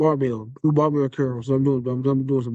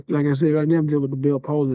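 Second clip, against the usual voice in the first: normal talking voice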